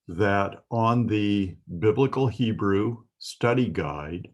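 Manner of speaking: usual speaking voice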